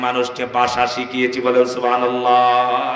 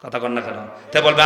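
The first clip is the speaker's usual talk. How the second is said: ordinary delivery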